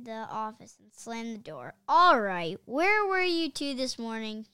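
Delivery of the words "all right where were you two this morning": loud, angry growl. A narrator's reading. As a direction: growl it at the boys like a furious principal. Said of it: "he growled".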